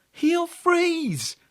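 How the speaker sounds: put-on a child fearing for his friend; boy anxiously warning grandad; worried boy. A narrator's reading.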